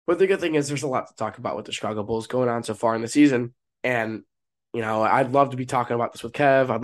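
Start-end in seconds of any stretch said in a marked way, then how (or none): none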